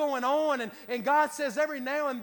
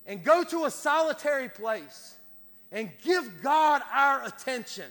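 slow, louder pulpit appeal with each phrase spaced out; measured preaching build; 4 dB louder than usual. Acted. Preaching the call to action.

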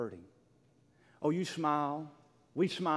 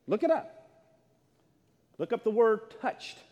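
much higher, loud urging call; excited urging; 8 semitones higher, 7 dB louder than usual. Acted. Pastor urging the church, high excited call. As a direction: fire them up to go look. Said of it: "urging congregation".

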